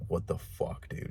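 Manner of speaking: breathy whisper of disbelief; hushed what-the-fuck whisper; stunned whisper. Retold or imitated. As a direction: whisper it in shock, half to yourself. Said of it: "it's making me just like".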